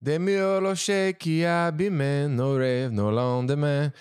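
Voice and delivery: slow, careful French lyric, sung-speak. Shown as a demonstration. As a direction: read out "De mieux le chien qui abîme nos rêves" slowly in shaky French. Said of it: "So it was like".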